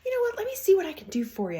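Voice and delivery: high, sugary salesman pitch; mock salesperson. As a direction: oversell it like a pushy salesperson. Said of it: "salesperson to salesperson where they're like".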